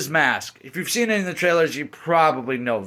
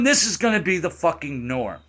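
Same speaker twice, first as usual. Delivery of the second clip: ordinary delivery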